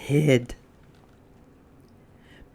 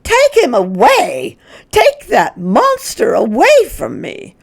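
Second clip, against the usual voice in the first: high, loud terrified shriek; 11 semitones higher, 7 dB louder than usual. Acted. Mrs Hipple shrieks at Mrs Prim to take Lyle away, loud and terrified. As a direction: shriek in panic at the crocodile. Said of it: "Missus Hipple began to shriek".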